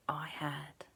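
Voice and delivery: soft secret whisper of a wish. Acted. Alexander whispering his wish into the well.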